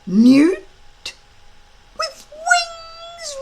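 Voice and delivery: high, indignant dragon voice; outraged like an insulted pet; offended little dragon. A narrator's reading. Small dragon outraged at being called a newt. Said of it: "repeated Toothless".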